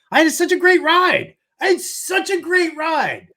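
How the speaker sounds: high, loud, emphatic cry; exuberant exclamation. Real, not acted. Host looking back on his life, exuberant and high-pitched. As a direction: proclaim it at the top of your range.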